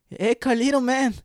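put-on high, loud Cuban-accented call; crazy Cuban accent. Imitating Lalin greeting Carlito, crazy Cuban accent. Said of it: "crazy Cuban accent".

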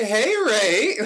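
high, loud cheerful greeting; excited mom answering. Retold or imitated. Mom answering her son's call, overjoyed. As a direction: beam into the phone. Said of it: "She's like".

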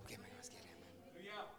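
hushed, breathy question to the congregation; whisper. Acted. Preacher whispering to the congregation, a hushed plea. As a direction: drop to a whisper and lean in.

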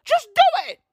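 very high, squealed command; exasperated cry. Acted. Comedian urging an oversharing father, high exasperated cry. Repeats advice as exasperated yell.

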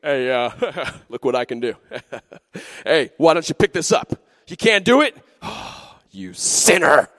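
taunting show-off with chuckles, building to a shout; mock a bragging bully, ending loud on the insult; hammed-up scornful strongman; big strong guy. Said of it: "he comes over and he goes".

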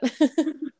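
short anxious laugh; nervous giggle; nervous laughter. Real, not acted. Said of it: "I'm laughing 'cause I'm nervous".

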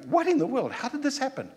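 high-pitched, amazed outcry; astonished crowd. Acted. Crowd reacting to disciples speaking foreign languages, astonished.

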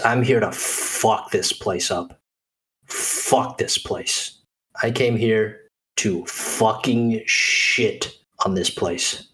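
very slow, low, half-voiced cursing; dazed, spent captive. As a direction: drag the words out, fading away. Dazed NPC's answer, voiced by GM.

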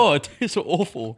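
talking through a burning mouth; mouth on fire. Real, not acted. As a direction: speak with a burning, spicy mouth. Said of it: "Mouth is on fire".

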